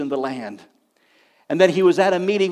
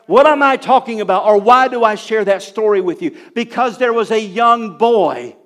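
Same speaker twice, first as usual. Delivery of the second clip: loud, raised, emphatic preaching; full-force preaching; 6 semitones higher, 9 dB louder than usual. Real, not acted. Preacher telling the congregation why he shared the story, forceful. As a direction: hammer the point home to the congregation. Preacher drives home the point.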